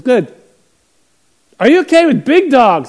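loud, high call from another room; shouted question. Retold or imitated. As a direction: holler a question from behind a wall. Man calls from behind wall.

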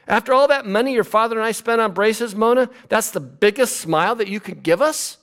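high, exasperated mom voice; a mother let down by a half smile; disappointed mother. Acted. A mother complaining to her daughter about braces, high exasperated mom voice. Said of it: "how about Mona Lisa's mother?".

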